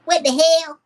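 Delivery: high-pitched, loud attempted impression; Steve Brown. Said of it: "What would Steve Brown say?".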